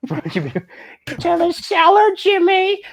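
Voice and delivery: very high, slow drawn-out yell; cartoonish high shout for Jimmy; Carl voice. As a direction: stretch it into a goofy high call. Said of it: "turned into Carl".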